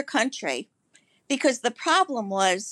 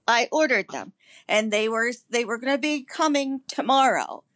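normal talking voice

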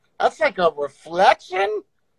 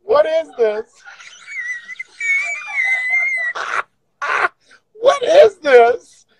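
high-pitched disbelief; 13 semitones higher, slower than usual. Real, not acted. Laughing freakout at filter.

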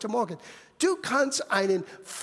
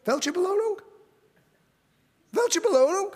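eager question; 6 semitones higher than usual. Retold or imitated. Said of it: "David fragte".

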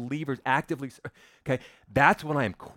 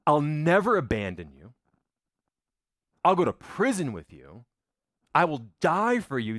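bold vow; 4 semitones higher, 4 dB louder than usual. Acted. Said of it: "Peter went from".

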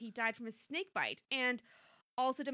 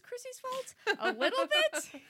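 sly laughing aside; slow, giggling tease; 13 semitones higher, 8 dB louder than usual. Acted. Narrator teasing that it was Chrissy's fault, laughing.

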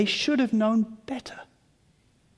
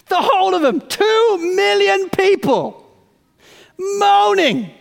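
higher, louder, laughing in disbelief; slow, incredulous emphasis on the crowd moaning; half-laughing exasperation at two million moaners; exasperated laughing disbelief; 9 semitones higher, 6 dB louder, slower than usual. Real, not acted.

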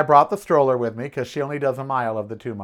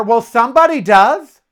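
raised, exasperated telling-off; indignant scolding; 6 semitones higher, 4 dB louder than usual. Retold or imitated. Neighbor scolding the woman who keeps calling 911, indignant raised voice. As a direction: scold her for abusing the system. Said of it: "And I said to her".

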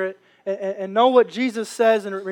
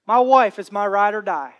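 ordinary talk, nothing marked

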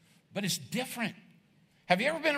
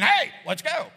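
higher, louder "Hey, let's go"; much louder pushy "Hey, let's go"; barking order; 8 semitones higher, 7 dB louder, slower than usual. Retold or imitated. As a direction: bark it as a demand.

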